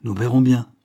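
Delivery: disgruntled and defiant; grumbling. A narrator's reading. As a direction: grumble it as a threat. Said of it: "grommela Landel".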